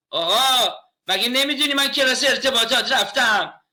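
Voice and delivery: mock outrage at a family member glued to the TV; mock scolding.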